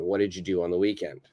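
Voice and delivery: fast, linked title read; quick reduced reading. Shown as a demonstration. Teacher reading the title fast and linked. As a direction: run the words together quickly. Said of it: "the lesson we're going to use today".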